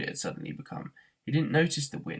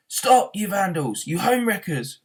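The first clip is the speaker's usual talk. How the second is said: high, loud, slow furious yell; yelling at the demolition men; brawling yell; 7 semitones higher, 7 dB louder, slower than usual. A narrator's reading. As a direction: bellow it at the wreckers. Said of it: "brawled Arthur".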